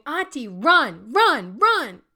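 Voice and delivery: put-on high, loud child's cheer; drawn-out, excited high shouts; gleeful child. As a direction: whoop it like a grinning kid urging auntie on. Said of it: "grinning smile on her face saying".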